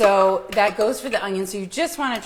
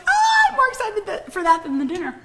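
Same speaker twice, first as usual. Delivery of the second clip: excited; 12 semitones higher than usual. Retold or imitated. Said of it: "I was like".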